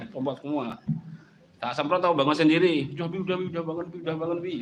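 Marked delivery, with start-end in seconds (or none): 2.9-4.5 put-on pleading child. Child begging after being sprayed.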